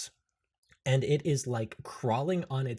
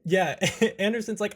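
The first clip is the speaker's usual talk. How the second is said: normal talking voice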